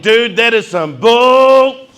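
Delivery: loud, high, slow and drawn out; indignant slow shout; loud slow retort. Retold or imitated. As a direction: spell it out loudly in disbelief. Retold argument with officer.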